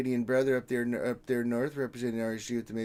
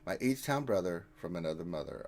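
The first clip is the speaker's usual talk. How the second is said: careful retry; 7 semitones lower, 5 dB quieter than usual. Shown as a demonstration. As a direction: say it cleanly, word by word. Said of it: "I had to say that five times fast".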